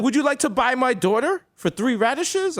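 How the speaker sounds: high-pitched medieval villager; hammed-up high bartering voice; peasant trader. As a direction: offer it like an earnest peasant at market. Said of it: "It was like".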